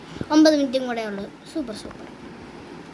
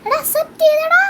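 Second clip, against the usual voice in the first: much higher, louder yell of joy; excited shout; 11 semitones higher, 6 dB louder than usual. Real, not acted. Gamer yells to viewers that the trade was accepted, thrilled shout. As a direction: scream it out in delight. Repeats it even more thrilled.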